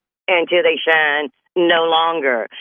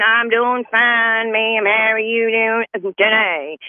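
slow, mannerly Southern reply; polite country accent; 5 semitones higher, slower than usual. Retold or imitated. Young man answering the neighbor, slow Southern drawl. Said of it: "this how we talk like up in here".

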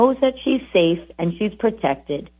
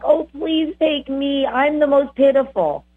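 pitiful pleading dog; 5 semitones higher than usual. Pretend. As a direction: play the sad dog begging to be taken. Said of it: "That's what they look at me like".

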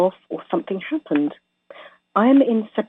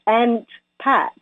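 Aunt Pat with an American flat a; American accent; 3 semitones higher than usual. Shown as a demonstration. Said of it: "I can do it in an American voice".

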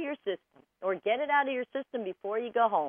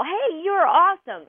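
"Hey, you're awesome" said higher, louder and bright with cheer; enthusiastic; 6 semitones higher, 4 dB louder than usual. Shown as a demonstration. A host showing how "you're awesome" can sound sincere and excited. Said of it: "It means something totally different".

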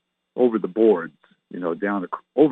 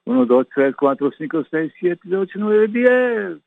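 counting in Spanish; 5 semitones higher than usual. Shown as a demonstration.

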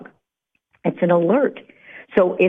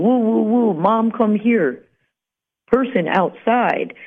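slow, higher-pitched woofing dog voice; dog barking alert; 5 semitones higher than usual. Acted. Dog alerting mom to a person outside, barking. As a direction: woof out an alert as the family dog. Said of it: "it's basically barking, going".

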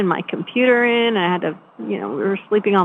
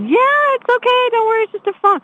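much higher, a bit louder, breezy comforting; cheery reassurance; 13 semitones higher, 3 dB louder than usual. Retold or imitated. Telling her husband on the phone not to worry, high and cheery. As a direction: brush it off brightly so he won't worry.

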